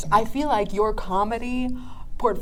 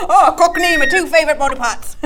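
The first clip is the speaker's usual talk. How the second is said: a light, hammed-up Cockney accent, a bit louder and higher; 5 semitones higher, 7 dB louder than usual. A podcast host joking for her guest in a mock Cockney accent. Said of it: "A Cockney accent".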